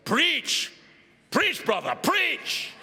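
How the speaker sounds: high-pitched church call-out; deacons' yell. Retold or imitated. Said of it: "the deacons yell".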